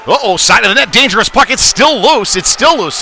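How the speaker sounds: sudden high, loud alarm at a loose puck; excited call. Real, not acted. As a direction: voice jumps up as the puck sits loose by the net.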